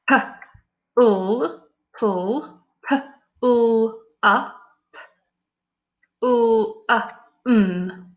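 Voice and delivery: each sound said separately, stretched; sounding out letters. Shown as a demonstration. Teacher blending sounds for pupils. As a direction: build the word up sound by sound. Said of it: "we're saying the first two".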